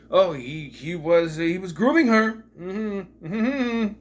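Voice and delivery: rising into high, loud mock alarm; mock outrage. Vlogger imitating overanalyzing video makers, in mock outrage. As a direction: parody a scandalized commentator.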